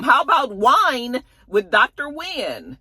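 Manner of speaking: high, slow, pleased-with-herself pitch; a high, drawn-out proud suggestion. Retold or imitated. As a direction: present the name like a grand reveal. Wendy pitching another name.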